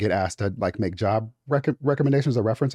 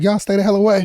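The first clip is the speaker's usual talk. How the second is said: loud, high, sassy warning; sassy outburst; 7 semitones higher, 5 dB louder, faster than usual. Retold or imitated. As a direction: play it big and sassy. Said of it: "I'd be like".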